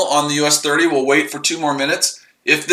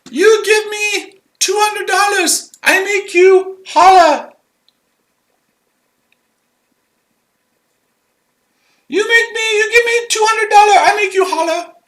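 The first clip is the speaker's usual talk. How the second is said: mock Indian accent. Mocking a scammer demanding two hundred dollars, high mock Indian accent. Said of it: "I speak India's accent".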